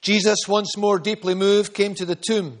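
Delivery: ordinary delivery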